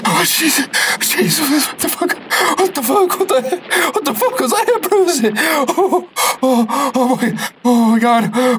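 high, frantic cursing in terror; panicked. Acted. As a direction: shriek out in panic at the ghost. Panicked at the ghost scene.

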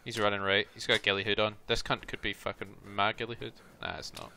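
ordinary delivery

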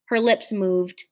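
usual speaking voice

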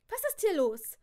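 loud, high demanding question; sharp demand. Acted. Alarmed cousin confronts the family, demanding. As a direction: demand to know what is happening.

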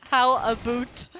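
loud, slow mock Canadian; mock Canadian accent. Player teasing a friend about Canadians, slow mock Canadian accent. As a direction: exaggerate a Canadian "about" as "a boot". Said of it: "Give me your best Canadian accent".

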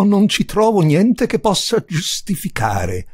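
ordinary delivery

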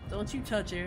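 stressed warning on "you"; mock protective. Streamer warns Sephiroth off Aerith, mock protective. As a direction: warn the villain off like a protective hero. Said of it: "I'm pretty sure he kills her later".

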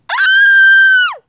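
long, very high pretend scream. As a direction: let out a piercing play scream. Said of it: "What are you screaming for".

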